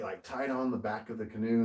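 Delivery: usual speaking voice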